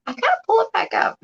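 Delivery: high, whimpering whine; mock crying whine. Shown as a demonstration. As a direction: whine it like a sulky child. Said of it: "repeated in a whiny take".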